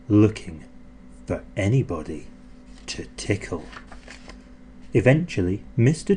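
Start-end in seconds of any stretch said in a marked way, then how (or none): 0.1-3.7 dropping to a whisper; softening to a secretive hush. A narrator's reading. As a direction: lean in and whisper it, sly. Narrator drops to hush.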